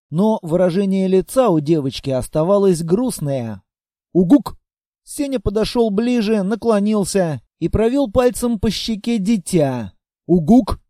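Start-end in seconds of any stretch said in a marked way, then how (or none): 4.1-4.6 short loud rising hoot; owl-like hoot. Acted. Monster hoots a loud reply to the girl. 10.3-10.8 short loud rising hoot; owl-like hoot. Acted. Monster hoots a loud reply to the girl.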